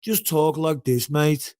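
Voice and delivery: demonstrating a broad Birmingham accent; drawn-out Brummie demo for a friend. Shown as a demonstration. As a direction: talk like a Brummie. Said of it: "try and do a Birmingham accent".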